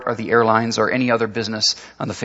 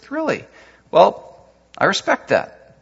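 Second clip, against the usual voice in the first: raised, mock-understanding coach; lightly voiced coach, higher; 8 semitones higher, slower than usual. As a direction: play the coach, sounding gracious.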